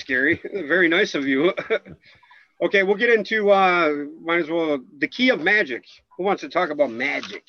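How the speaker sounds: ordinary talk, nothing marked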